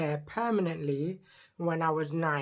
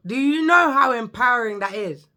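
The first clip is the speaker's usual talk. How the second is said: louder, emphatic; 5 semitones higher, 9 dB louder than usual. Real, not acted. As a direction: push it louder and higher.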